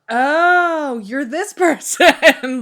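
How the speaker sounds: loud, high, drawn-out dawning recognition; big, slow "aha" with a laugh; sudden realization. Retold or imitated. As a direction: act out spotting the culprit, laughing. Retells sudden realization while reading.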